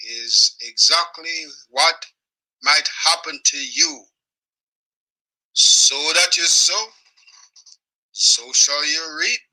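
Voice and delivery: very slow, word by word; slowed-down preachy proverb; solemn warning. Real, not acted. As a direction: lay down the proverb like a sermon.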